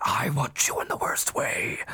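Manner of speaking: breathy whispered come-on; Robin Williams impression. Retelling the movie, a whispered Robin Williams line. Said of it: "montage of him just being Robin Williams".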